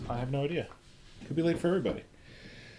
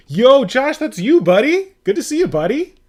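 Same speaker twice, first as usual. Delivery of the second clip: loud, high-pitched hollered hello; excited greeting; 19 dB louder than usual. Real, not acted. Streamer spotting a buddy in chat, excited high shout.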